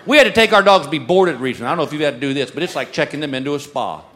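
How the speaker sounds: ordinary delivery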